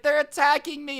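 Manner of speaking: imitating a woman claiming attack; mock scream. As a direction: wail they're attacking me. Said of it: "she's like".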